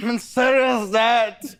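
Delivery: ordinary delivery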